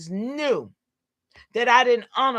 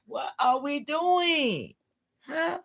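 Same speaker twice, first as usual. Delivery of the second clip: drawn-out exasperation; 5 semitones higher, 5 dB quieter, slower than usual. Real, not acted. Exasperated rhetorical question.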